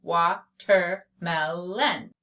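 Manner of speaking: "Watermelon" in four slow, even beats; "Watermelon" spaced out for counting; split into syllables. Shown as a demonstration. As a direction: say wa, ter, mel, on for "Watermelon".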